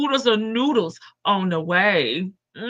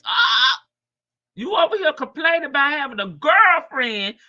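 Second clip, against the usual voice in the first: mock yell; 5 semitones higher, 4 dB louder than usual. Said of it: "I says".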